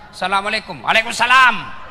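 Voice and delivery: loud, blunt greeting; mock stern woman on the phone; firm voice. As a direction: answer firmly, no softness. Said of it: "kasih tegas suaramu".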